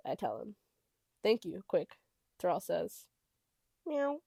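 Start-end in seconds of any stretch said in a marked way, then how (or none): 3.9-4.2 high cat meow. A narrator's reading. The sound startles me.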